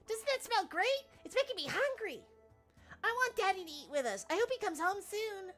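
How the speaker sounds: put-on high, sweet little kid; a small child longing for Daddy. Voices a child NPC.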